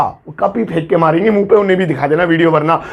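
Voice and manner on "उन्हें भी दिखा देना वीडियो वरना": usual speaking voice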